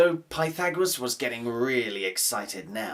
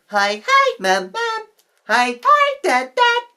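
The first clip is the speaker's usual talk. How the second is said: put-on high, loud doubled child voices; high shouting twins waving at parents; twins calling; 8 dB louder than usual. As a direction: call out like two excited little kids. Twins wave from the donkey.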